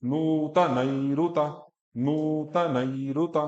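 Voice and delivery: नूतनैरुत said slowly, each syllable separate; slow model. Shown as a demonstration. Teacher repeats नूतनैरुत slowly for the student. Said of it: "तो आप बोलती सीधा नहीं start".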